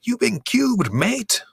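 high-pitched put-on British accent; mock British accent. Podcaster ribbing co-host, mock British accent. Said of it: "pretending you weren't using an accent again".

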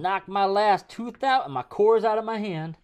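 high, loud, slow hillbilly griping; hillbilly accent. Acted. Griping about the dog and a lost tooth, hillbilly accent. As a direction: complain like an aggrieved hillbilly. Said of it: "talk like that".

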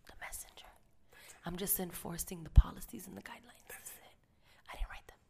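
hushed muttering to herself; whispered aside. Real, not acted. Whispering aside to the listener about the angry customer. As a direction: drop to a whisper as a sly aside.

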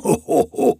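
high, breathy gloating laugh; evil giant laugh. A narrator's reading. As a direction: cackle over the caught bird. Giant gloating over prisoner.

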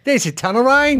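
a hint of Australian accent, high; lightly voiced Australian bloke. Asked by cohost, mild Australian accent. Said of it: "say this town name in an Australian accent".

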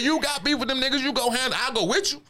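high-pitched acted comeback; indignant refusal.

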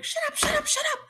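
high fangirl squeal; squealing disbelief. Retold or imitated. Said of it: "everyone in America was like".

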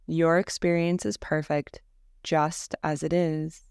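calm, even Yoga Nidra guidance with long pauses; guided meditation. Acted. Teacher guiding listeners through Yoga Nidra practice, calm guided-meditation voice. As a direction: lead them gently into rest, leaving space after each phrase.

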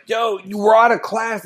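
mock shout of a party invitation; called-out invite. Retelling inviting Indy friends to party, in a mock shout. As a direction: yell it like you're hyped to party.

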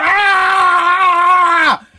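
loud, high scream of delight; excited scream. Pretend. An average Joe is signed by Nike and screams in delight. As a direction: scream at the jackpot. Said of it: "You'd be going nuts".

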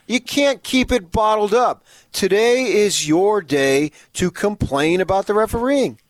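high, loud, emphatic tirade; fired-up rant. Pretend. Host urging fans to get it out of their system, fired up.

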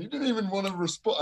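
high-pitched mock complaint; imitating a rowdy lad. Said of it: "in that exact kind of tone".

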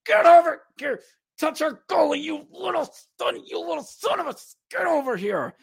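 high, loud furious yelling at the opponent; hammed-up enraged hockey player shouting get over here; angry teammate. Teammate yelling at the Phantom who touched the goalie, furious shout. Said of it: "Cale Kessy goes".

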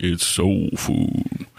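low, very slow soul-man voice. Acted. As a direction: drop deep and stretch it out. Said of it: "I was trying to be like".

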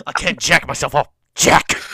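loud hammed-up wrestler shout; mock Hulk Hogan yelling. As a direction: yell it like a hammed-up wrestler promo.

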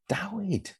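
"Dawid" as a soft, high-pitched query; questioning pronunciation. Shown as a demonstration. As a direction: try "Dawid" with a w, high and quiet. Tries ways to say the name.

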